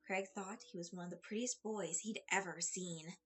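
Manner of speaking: plain reading voice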